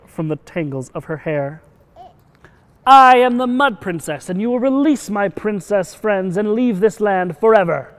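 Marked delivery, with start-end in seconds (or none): 2.9-7.9 high, loud, defiant proclamation; bold heroic declaration. Acted. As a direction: declare it loudly, standing up to a monster. Heroine confronts the dragon.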